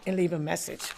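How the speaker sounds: normal talking voice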